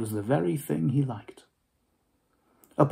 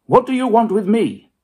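challenging Scrooge; 9 semitones higher, 8 dB louder than usual. Acted.

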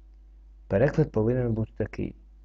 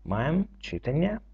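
ordinary talk

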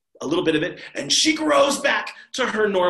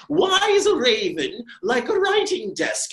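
high, louder riddling Hatter; Mad Hatter; 9 semitones higher, 5 dB louder than usual. Acted. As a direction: ask the riddle like a loon at a tea party. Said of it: "The Mad Hatter was like".

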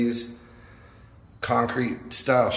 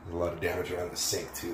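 ordinary talk

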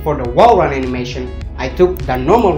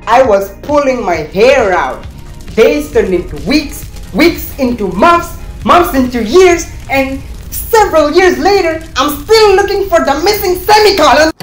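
exasperated ranting, climbing higher into a shout; mounting frustrated rant; 11 semitones higher, 7 dB louder than usual. Acted. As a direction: build to a high, loud meltdown. Acted meltdown over animation bugs.

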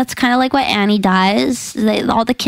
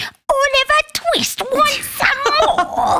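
mock scolding; slower than usual. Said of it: "they're just like".

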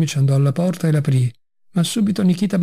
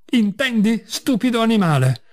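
restrained, narrated outburst in a higher pitch; angry cry; 6 semitones higher than usual. A narrator's reading. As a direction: scold indignantly without raising the volume. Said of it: "gridò Ivan Dmitrich".

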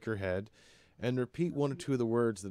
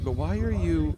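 higher, louder blurted question; Joan blurting; 8 semitones higher, 9 dB louder than usual. Acted. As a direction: start the question then catch yourself. Joan starts a nosy question.

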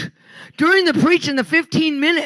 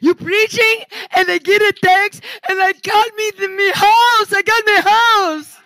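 quoted lady's joy climbs higher and louder; mock-thrilled lady, getting higher and louder; excited retelling; 6 semitones higher, 4 dB louder than usual. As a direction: build her voice up with delight as the house comes through. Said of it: "And she goes".

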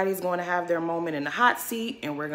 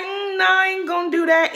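high-pitched mock refusal; Wendy; 11 semitones higher, 4 dB louder than usual. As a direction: push the refusal up high, drawn into the nose of a retold quote. Voices Wendy's refusal in retelling.

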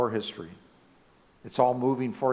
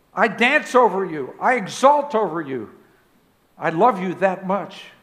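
God's fervent love; 6 semitones higher, 7 dB louder than usual. Acted. Preacher voices God's love.

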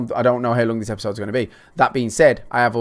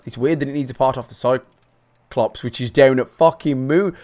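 normal talking voice

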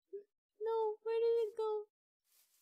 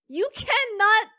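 loud, raised, incredulous cry; exasperated disbelief; 4 semitones higher, 10 dB louder than usual. Real, not acted. Player who may have lost the cat, exasperated. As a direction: burst out in disbelief.

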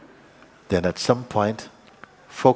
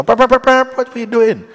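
much higher, louder alarmed yells; panicked yelling; 14 semitones higher, 9 dB louder than usual. Retold or imitated. Other drivers yelling at the panicking learner, loud alarm. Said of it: "People go".

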